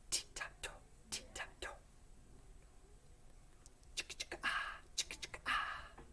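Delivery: breathy unvoiced tee, ta, to and chicka-chicka-ah; crisp whispered diction drill for echoing; whispered consonant drill. Shown as a demonstration. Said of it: "I'll go first. You be my echo".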